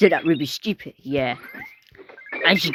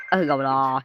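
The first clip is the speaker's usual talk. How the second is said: normal talking voice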